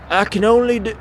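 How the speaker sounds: put-on English accent, pitched well up; English accent attempt. Streamer who can't do Scottish, attempting an English accent. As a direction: try an English accent, high and hammy. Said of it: "can only do the English accent".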